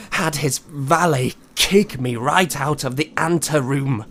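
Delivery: usual speaking voice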